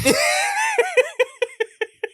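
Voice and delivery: high, shouted burst of laughter; big laugh. Real, not acted. Laughing after acting the scene.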